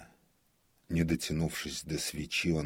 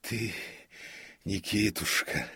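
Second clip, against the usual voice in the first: higher, halting, spent voice; weak muttering; 5 semitones higher than usual. A narrator's reading. Exhausted comic reaches to prompter, feeble mumble. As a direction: falter between the words, drained. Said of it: "бормотал обессилевший Колхаз".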